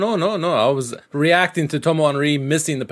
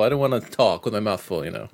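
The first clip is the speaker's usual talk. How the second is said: usual speaking voice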